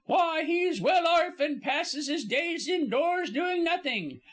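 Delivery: high-pitched indignant Cockney servant; huffy, high gossip building her case. A narrator's reading. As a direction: fire off scandalised questions in a high voice. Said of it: "cried Missus Baskett indignantly".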